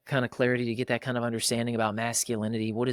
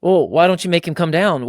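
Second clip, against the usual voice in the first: worried question; 6 semitones higher, 10 dB louder than usual. Retold or imitated. Worried question about the climbing son.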